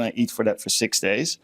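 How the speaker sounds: normal talking voice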